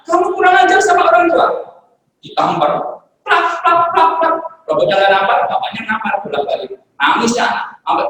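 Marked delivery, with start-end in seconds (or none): 0.0-1.5 high, loud rebuke; angry father. Acted. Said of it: "Bapaknya marah". 3.2-4.4 slap sounds. Retold or imitated. Imitating slaps.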